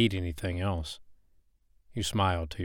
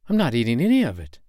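louder, fast, adamant refusal; firm refusal; 4 semitones higher, 6 dB louder, faster than usual. Acted. Woman refusing the man's snake supper, adamant. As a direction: shut it down flatly and fast.

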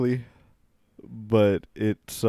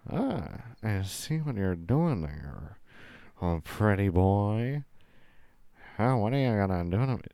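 softer, nasal fifties screen siren; mock sexy nasal; 5 dB quieter than usual. Host playing a fifties movie actress flirting, mock sexy nasal voice. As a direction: purr it through the nose, hammed-up seductive. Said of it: "she's trying to be all sexy".

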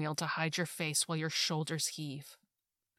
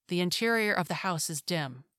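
ordinary delivery

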